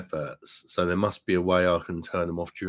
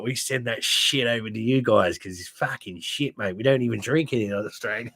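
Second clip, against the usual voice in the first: higher-pitched mock Aussie accent; imitated Australian accent; 4 semitones higher than usual. Australian workmate teasing Foster's drinkers, imitated Australian accent. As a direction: take off an Australian workmate taking the piss. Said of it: "I used to work with an Australian guy".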